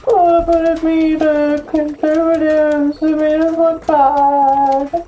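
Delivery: high, slow mocking whine; whiny complainer voice. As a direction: mimic a whiny complainer, drawn out. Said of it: "they're like".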